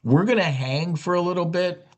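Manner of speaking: high, chirpy voice for a chart; chart talking. Pretend. Host voices the stock chart as a chatty character. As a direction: give the chart a squeaky little voice. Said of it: "a chart that says".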